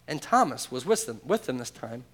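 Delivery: normal talking voice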